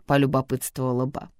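ordinary delivery

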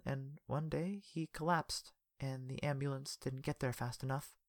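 slow, quiet sorrow; sad and quiet. Acted. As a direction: fade into sadness. Telling of death.

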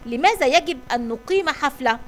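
ordinary delivery, nothing marked

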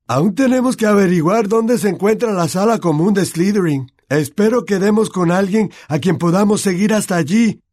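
Ron's character voice. Acted. Ron urging Harry to find the Slytherin common room, urgent and loud. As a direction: push the plan forward, eager.

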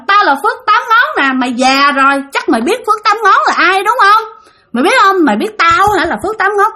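high-pitched boasting spirit; Phước Tám Ngón. Acted. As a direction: brag and demand to be recognised. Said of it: "cái chị này nói làm sao các bạn biết không". (giọng vong cao, hống hách xưng danh)

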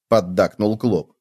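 usual speaking voice

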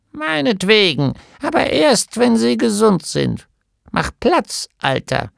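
put-on bossy old healer brushing off her husband; resolute gnome wife. A narrator's reading. (Gnomenweib fährt ihren Mann an, höher und lauter)

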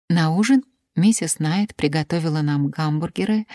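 plain reading voice

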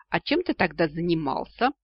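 ordinary delivery

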